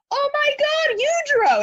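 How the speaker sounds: high-pitched mock fanboy squeal; hammed-up starstruck outburst. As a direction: gush like an over-the-top fan spotting an idol. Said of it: "literally kept saying".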